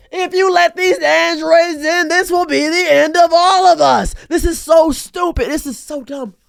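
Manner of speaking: high, far-off android warning; a mock sci-fi prophet of doom; robotic doom voice. Ranting vlogger mocks buyers in a doom voice.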